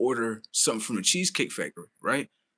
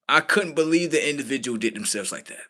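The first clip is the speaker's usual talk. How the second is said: ordinary talk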